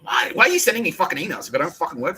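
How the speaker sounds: mock rant. Ranting at the woman.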